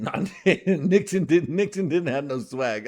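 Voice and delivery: cracking up while correcting himself about Nixon; laughing. Real, not acted. Laughing at his own Nixon claim.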